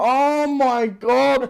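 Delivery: high, slow stretched cry; slow high moan of pain; drawn-out pained moan. Retold or imitated. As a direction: drag it out in agony. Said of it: "like that".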